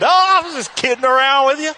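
high, loud, over-jolly excuse; hammed-up playing it off as a joke; sheepish backpedal.

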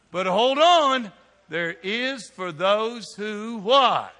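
slow, drawn-out setup for the answer; slow call-and-response. Acted. Preacher slows to draw the answer from the crowd. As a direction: hold it and make them wait. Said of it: "slow call and response".

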